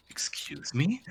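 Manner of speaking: whispered, affronted excuse me; offended whisper. Acted. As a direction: hiss it in disbelief. Noblewoman offended.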